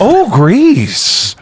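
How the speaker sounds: high, loud impressed mother; impressed mom. Retold or imitated. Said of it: "That's what you tell your parents".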